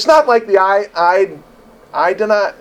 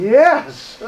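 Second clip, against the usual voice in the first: excited yes; 10 semitones higher than usual. Retold or imitated. Retold joy at free rent.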